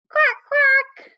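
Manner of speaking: high, loud playful duck quack. Pretend. Teacher to toddlers, a high, loud duck quack. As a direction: quack it out big for the kids.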